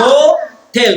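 "Hotel" as two held beats, হো and টেল; split into syllables. Shown as a demonstration. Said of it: "কয়টা, কয়টা syllable হলো?".

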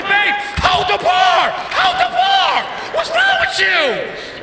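high-pitched, drawn-out frantic yelling; mock panicked shout. Terrified husband yelling at wife mid-drop, panicked. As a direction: shriek it in comic terror.

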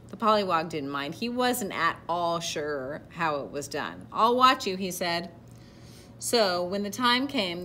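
animated read-aloud with deliberate emphasis; picture-book narration. A narrator's reading. Reader telling children of a proud caterpillar, storybook narration. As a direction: read to children, projecting key words.

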